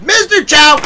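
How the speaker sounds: hammed-up hail; mock call-out. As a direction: summon him theatrically. Calling out a name loudly.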